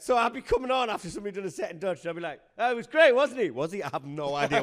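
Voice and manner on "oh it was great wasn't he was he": loud, high mock enthusiasm that drops into doubt; clueless MC bluffing. MC following an act in a language he doesn't know, bluffing praise. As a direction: gush loudly, then question it.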